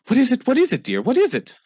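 high, anxious wife's voice; worried mother. Acted. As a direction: rush in asking what's wrong. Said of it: "your mother comes".

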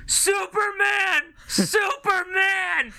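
high, drawn-out stadium yell of Superman; long, high mock heckler cry; drunk fan yelling. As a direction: bellow it like a drunk fan in the stands. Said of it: "he kept on yelling".

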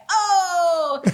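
loud, high, thrilled oh; enthusiastic oh. Retold or imitated. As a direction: imitate someone bursting with enthusiasm. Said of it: "the enthusiasm".

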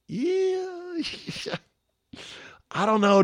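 high, breaking into a real laugh. Real, not acted. Host laughing about a listener flying to Vegas, amused. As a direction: laugh it off, half disbelieving.

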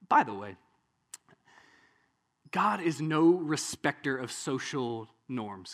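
usual speaking voice

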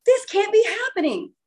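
high-pitched, incredulous retelling; retold overwhelmed disbelief. Reliving the laughing-crying moment for viewers, overwhelmed disbelief. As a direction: pitch leaps up as if overcome. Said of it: "Now I'm laughing, crying".

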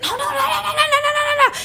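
very high, rapid-fire squeal of protest; hammed-up group panic; panicked pleading. Everybody panicking at opening the door.

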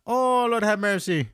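high, slow fake Jamaican accent; hammed-up Miss Cleo Jamaican accent. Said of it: "fake Jamaican accent".

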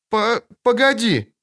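put-on high, hesitant child's voice; faltering plea. A narrator's reading. Girl begs departing witcher to wait, faltering. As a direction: stumble on the first syllable, slowly.

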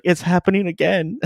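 high-pitched panicked coworker act; mock dread. Imagined coworker watching a man get possessed, mock dread. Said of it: "someone's gonna be like".

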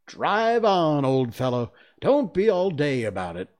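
loud, high impatient call; impatient urging. A narrator's reading. Gryphon hurrying the Mock Turtle along, loud and impatient. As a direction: urge him on loudly, pitched high.

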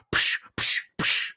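breathy psh like a movie punch hitting; exaggerated punch sound. Imitating the movie's punch sound for a co-host, exaggerated psh. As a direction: spit out a cartoonish punch effect. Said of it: "almost an exaggerated Batman".

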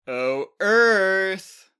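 loud, high booming greeting; a giant bellowing a teasing hello. A narrator's reading. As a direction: boom it out like a mocking giant. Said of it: "Bacchus boomed".

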